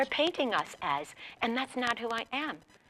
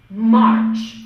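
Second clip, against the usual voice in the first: loud, drawn-out command; teaching a word; 13 dB louder than usual. Shown as a demonstration. Teacher teaching her drum a word, loudly declaimed. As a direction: call the word out forcefully to be copied. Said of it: "I'm going to teach a word".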